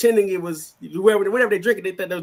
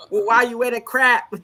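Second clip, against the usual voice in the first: put-on a playful, slightly higher take on a Boston accent; mock Boston accent; 7 semitones higher, 4 dB louder than usual. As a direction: tease the Boston accent like a friend would. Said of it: "you know how they talk in Boston".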